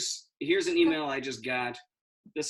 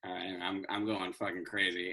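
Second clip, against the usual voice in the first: muttered aside; 7 semitones lower, 7 dB quieter than usual. Acted. Muttered after mom.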